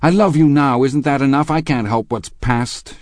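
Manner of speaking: high, loud plea, easing at the end; distressed cry. A narrator's reading. Daisy pleads with Gatsby about the past, crying out. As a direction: plead desperately, near breaking. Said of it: "she cried to Gatsby".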